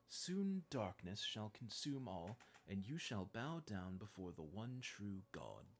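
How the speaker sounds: slow, hissing villain with big swells; menacing slow villain, hissed then booming; Liquid Snake voice. Acted. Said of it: "I can do the Liquid Snake voice".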